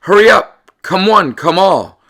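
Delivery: high, loud excited call; a barker's shout to the crowd. Acted. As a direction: summon the whole crowd to the game. Story crowd calling out.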